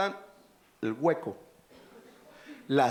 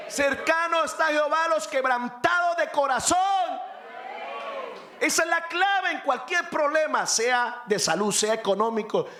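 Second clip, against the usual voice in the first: fiery preaching; 7 semitones higher than usual. Acted. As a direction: preach it hard, rising with fervor. Preaching at full force.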